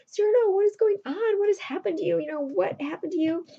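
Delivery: high-pitched alarmed questioning, loud at first. Acted. Roxanne sees Cyrano's bandaged head, alarmed. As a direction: act out a shocked cry, starting high and loud.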